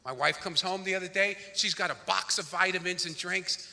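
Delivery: ordinary talk, nothing marked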